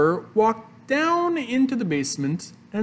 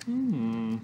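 ordinary delivery

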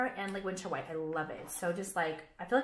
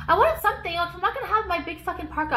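excited burst; 9 semitones higher, 4 dB louder than usual. Real, not acted. Excited rant about winter clothes.

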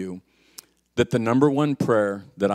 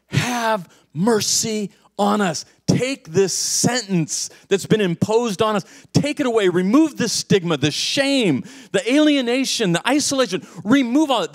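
high, urgent pleading, building in force; crying out; 8 semitones higher, 3 dB louder than usual. Acted. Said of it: "That's what they're crying out for".

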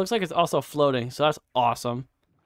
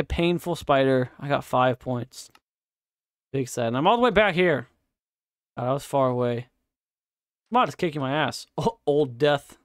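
ordinary talk

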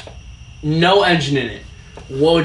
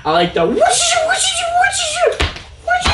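turbo whoosh imitation. Imitating turbo blow-off sound.

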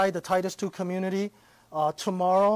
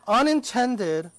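unintended said slowly and pitched up; unintended, stretched slow and raised; stress on unintended; 3 semitones higher, slower than usual. Shown as a demonstration. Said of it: "underline that word".

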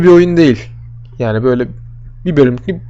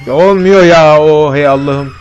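frustrated outburst; 6 dB louder than usual. Real, not acted. Failing again in the game.

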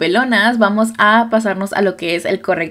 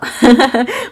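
amused high laugh; 4 semitones higher than usual. Real, not acted. Laughs after taco joke.

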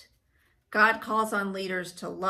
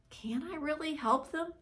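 soft, raised, hesitant question; self-doubting thought; 5 semitones higher, 7 dB quieter than usual. Retold or imitated. Child asked to help a friend, quietly doubting. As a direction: wonder it quietly to yourself.

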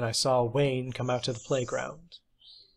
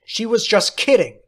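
put-on higher, louder child's yell; angry yell; 8 semitones higher, 7 dB louder than usual. A narrator's reading. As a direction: shout back in defence. Playground quarrel.

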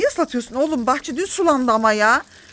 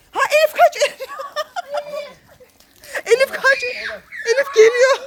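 laughing warning shout; 12 semitones higher than usual. Real, not acted. Mother warns daughter the hose is coming, laughing high. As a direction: shriek a warning while laughing. Son chases sister with hose.

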